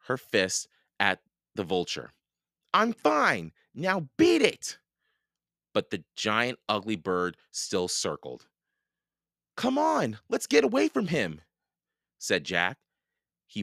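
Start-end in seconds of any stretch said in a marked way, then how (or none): 2.7-4.8 high, loud telling-off; indignant shout. A narrator's reading. Annie shaking her fist at vulture, indignant shout. 9.5-11.4 high-pitched, hurrying plea; urgent urging. A narrator's reading. Jack urging Annie away from vulture, urgent.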